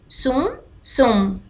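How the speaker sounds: سم drawn out slowly; teacher sounding out سم slowly. Shown as a demonstration. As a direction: say سم slowly and carefully. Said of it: "ما هذا الذي أشاهده".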